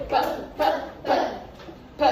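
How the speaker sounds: "P" as a short "puh", not the letter name; letter-sound P. Shown as a demonstration. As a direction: pop each "P" out as a tiny puff. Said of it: "Qual é o som da letra P".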